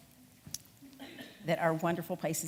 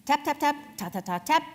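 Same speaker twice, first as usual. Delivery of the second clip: tapping sound; 6 semitones higher, 6 dB louder than usual. A narrator's reading. Knocking sound read aloud.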